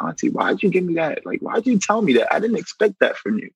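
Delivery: high-pitched exasperated plea; a hint of a hurt nephew; pleading with auntie. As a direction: raise the voice, hurt and baffled. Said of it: "we crash heads because I'm like".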